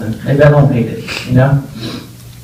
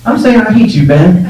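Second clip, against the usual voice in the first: indignant retort; 6 semitones higher, 4 dB louder than usual. Retold or imitated. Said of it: "I just thought".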